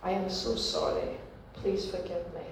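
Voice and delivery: hushed, humble apology; soft apology. Retold or imitated. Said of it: "phone this relative and say".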